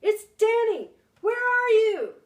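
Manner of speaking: high loud shout into the woods; a boy shouting through a dark wood; calling out. Acted. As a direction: call as loud as you can for dad. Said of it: "I shouted".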